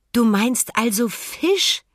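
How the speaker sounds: langsam, gedehnt und höher fragen; erkennendes Nachfragen. A narrator's reading. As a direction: endlich verstanden, amüsiert nachgefragt. Emma versteht endlich, lacht. (langsames, hohes Nachfragen)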